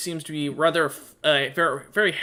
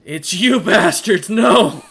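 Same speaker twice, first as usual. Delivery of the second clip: a loud, furious outburst, higher than his usual voice; angry shout; 5 semitones higher, 9 dB louder, slower than usual. Acted. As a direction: Czar spots the traitors and bellows at them. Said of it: "And he goes".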